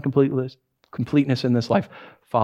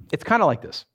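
ordinary talk

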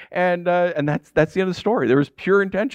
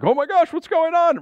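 high startled cry of alarm; shocked at the smoke in the house; 13 semitones higher than usual. Retold or imitated. Pastor retelling the smoking dishwasher, alarmed high cry. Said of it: "I'm like".

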